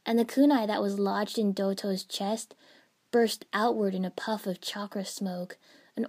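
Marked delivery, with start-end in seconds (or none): none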